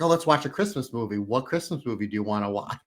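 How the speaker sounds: normal talking voice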